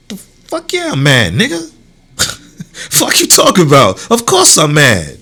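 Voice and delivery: loud, heated comeback; mock mad reply. Answering someone who asks if he's mad, heated. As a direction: snap back like you're obviously mad.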